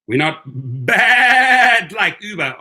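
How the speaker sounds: long, loud, high bleat on "bad"; goat-bleat pun. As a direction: stretch "bad" into a goat's baa. Joke slogan with goat bleat.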